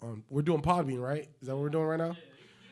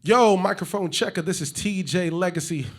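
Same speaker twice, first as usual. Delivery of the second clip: loud, high announcer hype; hype intro; 6 semitones higher, 12 dB louder than usual. Acted. Host hyping the podcast intro, loud and high. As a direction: shout the intro like a radio host.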